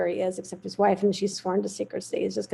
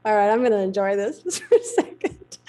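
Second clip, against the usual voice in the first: amused, laughing; 4 dB louder than usual. Real, not acted. Savouring the cowboys chasing her.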